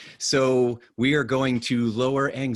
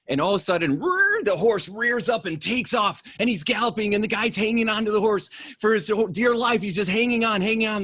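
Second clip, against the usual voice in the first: much higher, building thrill as the ride runs away; excited storytelling; 9 semitones higher, 3 dB louder than usual. A narrator's reading. Speaker telling the audience about the runaway horse, rising excitement. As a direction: race through the runaway ride, voice climbing high.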